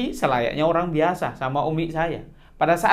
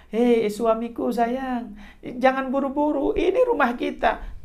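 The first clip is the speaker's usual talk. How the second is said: higher, slow, sweet wife's voice; a touch of the wife; 9 semitones higher than usual. Retold or imitated. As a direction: coax your husband gently, pitched up. Wife stops hurrying husband tenderly. (sedikit suara istri, tinggi dan pelan)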